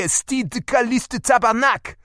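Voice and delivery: mock Quebecois mother swearing; Quebec French swearing. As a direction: fire off Mom's Quebec French oath. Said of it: "As Mom would say".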